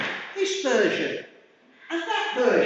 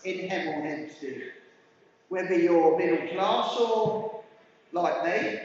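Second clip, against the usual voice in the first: normal talking voice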